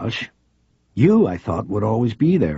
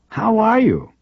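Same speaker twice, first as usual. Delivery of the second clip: female reporter voice; 3 dB louder, slower than usual. Acted. Reporter greets old cop friend.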